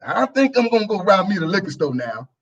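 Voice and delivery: usual speaking voice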